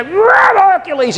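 high-pitched mock Hercules roar; boastful arena roar. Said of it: "he'd go".

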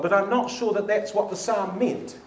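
ordinary talk

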